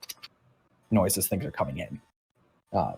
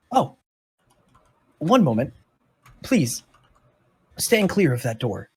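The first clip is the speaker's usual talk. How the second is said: effusive robot creature; 2 semitones higher than usual. Acted. Decadron thanking the visitors over a returned specimen, effusive role voice. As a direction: play a grateful, fussy robot keeper.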